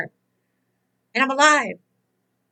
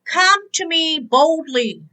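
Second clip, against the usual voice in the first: each word drawn out and weighty; slow emphatic scripture; 3 semitones higher, much slower than usual. Real, not acted. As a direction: read it slowly, like holy words. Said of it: "it says".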